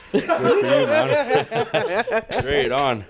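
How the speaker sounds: words squeezed out through a high burst of laughter; cracking up while teasing a friend; laughing out loud. Real, not acted. Friend teasing a drug joke.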